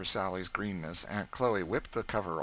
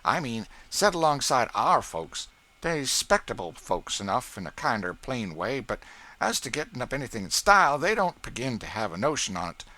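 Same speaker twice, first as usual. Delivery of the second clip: Aunt Chloe's voice, higher and louder, mocking the neighbours; proud servant belittling another household, raised; Aunt Chloe scornful of rivals; 5 semitones higher, 6 dB louder than usual. A narrator's reading. Cook boasting her household over the Lincolns, scornful.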